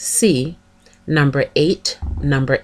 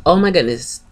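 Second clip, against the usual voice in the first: ordinary delivery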